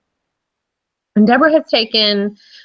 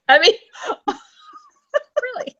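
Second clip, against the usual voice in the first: laughing; faster than usual. Real, not acted. Joke about tagline.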